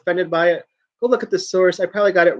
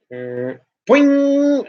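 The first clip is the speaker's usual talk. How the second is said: cartoon boing; 8 semitones higher than usual. Mimics cat springing up.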